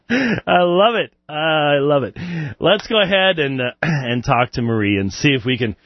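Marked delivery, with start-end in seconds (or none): 0.1-2.2 laughing through the words; amused, drawn-out relish; delighted laughter. Real, not acted. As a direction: savour it while recovering from a laughing fit. Still laughing at the question.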